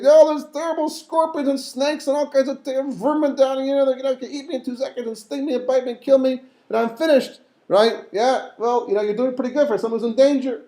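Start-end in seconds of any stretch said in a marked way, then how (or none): 0.0-7.4 higher, pleading cry from the pit; panicked Yosef. Retold or imitated. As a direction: play a frightened Yosef begging, hammed-up. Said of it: "Yosef comes back and says".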